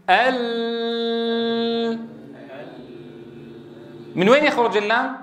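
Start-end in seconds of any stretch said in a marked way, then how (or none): 0.1-2.0 "ال" with the lam held long and steady. Shown as a demonstration. Teacher asking students to repeat a long "ال". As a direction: sustain "ال" on one note. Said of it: "قل معي".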